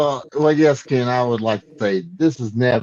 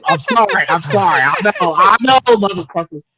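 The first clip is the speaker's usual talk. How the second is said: higher and louder, words tumbling out; excited blurt; 7 semitones higher, 4 dB louder than usual. Real, not acted. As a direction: burst in with a sudden thought, all worked up. Excited rush to add a joke.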